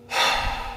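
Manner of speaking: long unvoiced weary sigh; heavy sigh. Real, not acted. Overwhelmed by sad stories, a heavy sigh. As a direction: let out a heavy breath of sorrow.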